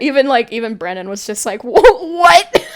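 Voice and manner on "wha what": high, loud stammered mock shock; stunned disbelief. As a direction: stumble into a loud, baffled cry. Retold game master's shocked reaction.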